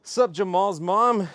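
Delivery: loud, slow, drawn-out hello; loud greeting. Real, not acted. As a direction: call out a greeting to a friend. Greeting chat by name.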